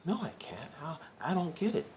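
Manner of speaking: high, whiny mock protest of a frustrated student; whiny doubter. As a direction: pitch it up like a beginner who gives up. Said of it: "And you might be like".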